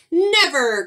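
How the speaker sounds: loud, high outcry falling away; emphatic storybook shout; cried refusal. A narrator's reading. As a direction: cry out in flat refusal. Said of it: "cried Skunk".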